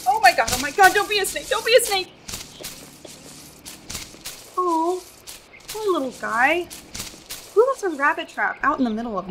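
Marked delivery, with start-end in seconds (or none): 0.1-2.1 high, loud, rising panic; panicked. Acted. Traveler frightened of a snake in the grass, panicking. 4.6-6.7 tender pity. Acted. Finds rabbit in trap.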